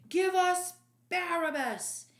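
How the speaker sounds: loud, high crowd yell; crowd shout. Acted. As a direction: roar it as one mob. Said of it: "they responded as one".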